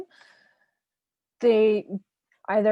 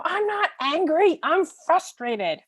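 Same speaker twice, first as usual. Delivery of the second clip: put-on higher, indignant child voice, louder; child correcting parent; 9 semitones higher, 6 dB louder, slower than usual. Child correcting parent who misnamed the feeling, in an indignant voice. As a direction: play a kid setting the record straight, the frustrated part slow. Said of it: "And they go".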